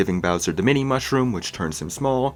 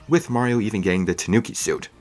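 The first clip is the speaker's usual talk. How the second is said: ordinary delivery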